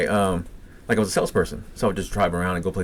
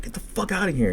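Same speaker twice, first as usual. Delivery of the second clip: high-pitched angry outburst, retold; heated reenactment at normal volume; angry retold order; 12 semitones higher than usual. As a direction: imitate yourself snapping at someone, pitch jumping up. Retold snapping, ordering her out.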